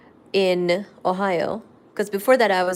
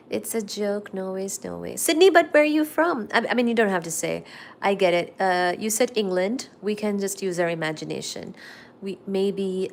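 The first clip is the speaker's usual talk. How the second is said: usual speaking voice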